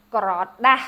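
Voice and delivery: "gra da" with "da" stressed; stress on second syllable. Shown as a demonstration. Teacher shows the accent in the word for napkin. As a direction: land on "da". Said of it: "second syllable is your accented".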